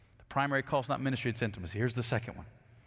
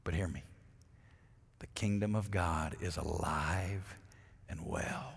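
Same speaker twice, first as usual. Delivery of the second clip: hushed declaration; 8 semitones lower, 9 dB quieter than usual. Acted.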